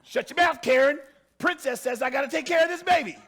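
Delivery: loud, high, triumphant brag; mock proud shout. Mother walking through town, loudly bragging to Karen. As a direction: strut and yell it at the neighbour. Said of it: "walking through town like".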